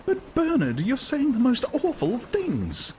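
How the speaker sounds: high, slow horrified woman's voice; a woman scolds him in dismay; shocked Lenina. A narrator's reading. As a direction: recoil from his words. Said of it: "Lenina shocked".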